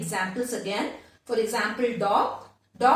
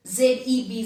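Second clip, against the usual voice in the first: usual speaking voice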